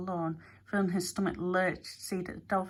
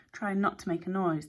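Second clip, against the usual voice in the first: ordinary delivery, nothing marked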